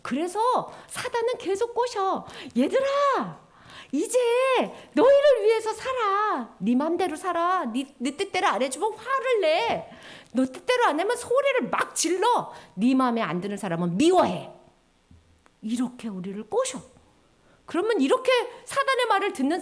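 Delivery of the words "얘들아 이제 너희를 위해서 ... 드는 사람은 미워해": put-on higher, sly tempter luring the kids; coaxing tempter voice. Satan tempting the children, higher sly coaxing voice. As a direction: coax them like a sweet-talking devil.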